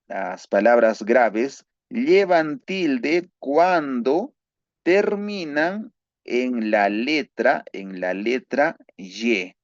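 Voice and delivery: slow, drawn-out rule dictation; dictating the second spelling rule slowly. Shown as a demonstration. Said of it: "dictating second rule slowly".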